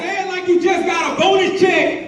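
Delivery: high, loud goading call; urging shout. Real, not acted. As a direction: fire up the chanting crowd. Urging crowd to chant harder.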